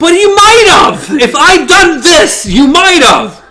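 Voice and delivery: high, loud acted outburst; retold mad shout. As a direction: shout it back, furious and high-pitched. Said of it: "she's just so mad".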